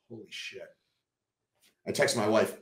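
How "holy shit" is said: very soft, awed disbelief; hushed amazement; retold stunned reaction. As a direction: breathe it out almost inaudibly. Stunned at news of surgery.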